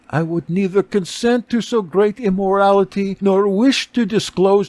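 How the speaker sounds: a noble lady recounting her ruin; beggar woman's account. A narrator's reading.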